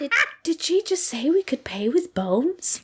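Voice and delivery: hushed unvoiced aside; a disbelieving whisper to a friend. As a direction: whisper it so the fairy won't hear.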